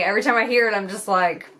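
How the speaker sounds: ordinary talk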